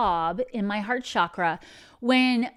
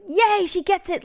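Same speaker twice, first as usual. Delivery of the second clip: bright, much higher cheer of delight; cheering angels; 9 semitones higher, 5 dB louder than usual. Retold or imitated. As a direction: cheer like a happy angel who finally got through. Angels rejoicing, voiced by speaker.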